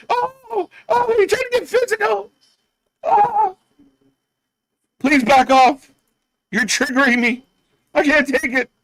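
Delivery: fake-terrified delicate wrestler; mock frightened. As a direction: pretend to panic at a tiny shove. Mocking scared wrestler.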